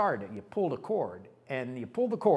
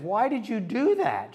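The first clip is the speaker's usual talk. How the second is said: higher, louder and slow; retold outrage; 10 semitones higher, 7 dB louder, slower than usual. Husband asking his wife why she sold it, higher and louder. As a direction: demand it in disbelief.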